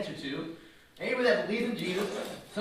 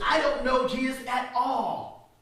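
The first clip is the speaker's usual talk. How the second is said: loud denial; 6 semitones higher, 5 dB louder than usual. Retold or imitated. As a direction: draw it out and push it louder. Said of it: "And he says".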